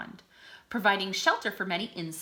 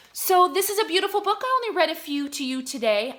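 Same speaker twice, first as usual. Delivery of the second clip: much higher, louder and quick with delight; excited; 9 semitones higher, 7 dB louder, faster than usual. Real, not acted. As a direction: gush about the book. Enthusing about the book.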